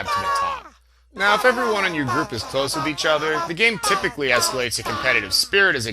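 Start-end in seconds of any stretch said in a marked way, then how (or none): 0.0-0.7 sudden high-pitched yell. Acted. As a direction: shoot the last words up into a yell. Punchline yelled high.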